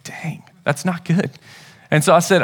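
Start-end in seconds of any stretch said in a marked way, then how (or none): 0.0-1.3 slow, whispered private reaction; stunned whisper. Retold or imitated. Dad hit by his son's words, stunned whisper. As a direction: whisper it to yourself, taken aback.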